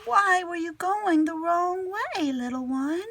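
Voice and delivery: put-on tender to a baby. Girl talks tenderly to hatchling.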